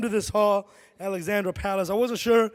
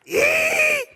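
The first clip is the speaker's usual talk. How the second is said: put-on loud high toddler squeal; 5 dB louder than usual. Answering his wife on Monday morning with a toddler squeal. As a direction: shriek like a baby refusing to get up. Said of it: "He'd go".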